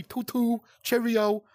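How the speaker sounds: a hammed-up toff voice, slow and high; mock posh talk. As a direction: sneer at prissy talk by hamming up the fancy words. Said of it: "Motherfuckers don't talk like that".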